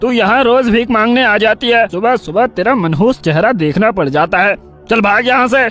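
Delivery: irate man berating someone; angry scolding. A narrator's reading. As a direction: scold a beggar and chase them off. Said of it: "लाली पर गुस्सा होकर उसे डांटने लगता है".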